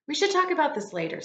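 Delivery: hurried mom. Acted. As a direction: hurry to shut the topic down. Teacher voicing mom in story.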